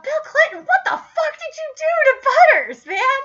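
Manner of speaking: very high, incredulous outburst; mock outrage. Fan confronting Bill Clinton about Butters, incredulous. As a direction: demand an answer in high-pitched disbelief.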